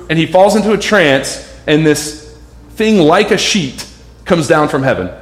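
usual speaking voice

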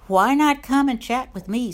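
high, loud carefree grasshopper call. Acted. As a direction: call out breezily as a lazy grasshopper. Grasshopper invites ant to chat.

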